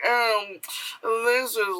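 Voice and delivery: high, put-on gallery critic; mocked art snob. Mimics judgmental gallery visitor.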